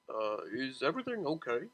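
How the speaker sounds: soft, lightly voiced kindly driver; a hint of a caring cab driver; gentle driver. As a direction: ask with concern.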